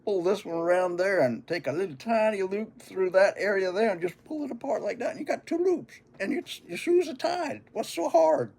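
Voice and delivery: big brother showing off how easy it is; voicing older brother. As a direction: talk him through it like a know-it-all big brother. Big brother explains tying shoes.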